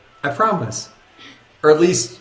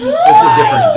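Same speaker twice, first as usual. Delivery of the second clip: excited squeal; 10 dB louder, faster than usual. Real, not acted. Squeals over the picture.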